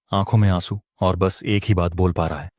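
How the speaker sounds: usual speaking voice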